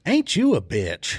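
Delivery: mocking sneer. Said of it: "she goes".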